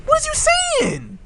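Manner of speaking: high-pitched, heated rant; worked up. Real, not acted. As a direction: fire it off high, exasperated and riled up. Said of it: "He got me hot".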